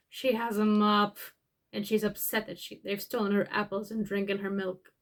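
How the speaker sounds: ordinary delivery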